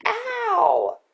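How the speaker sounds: piercing high ghostly shriek of pain; horrifying shriek. Acted. Dede voicing the ghost sister's pain, horrifying high shriek. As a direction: shriek so the listeners' skin crawls. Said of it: "Dede gave a horrifying shriek".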